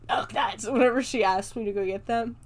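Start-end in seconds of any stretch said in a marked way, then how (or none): 0.1-0.7 raspy little gremlin imitation; mimicking a gremlin's croak. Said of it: "I, like, always go".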